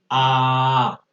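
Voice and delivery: long, steady AH; sustained Ah. Shown as a demonstration. Teacher has students feel the throat vibrate, sustained AH. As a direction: hold an open AH on one note. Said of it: "digan así".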